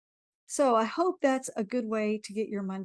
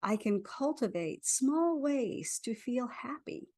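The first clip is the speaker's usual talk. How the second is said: guided affirmation. Acted. Leads a tapping affirmation.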